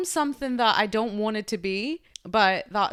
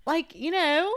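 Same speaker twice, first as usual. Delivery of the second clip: normal talking voice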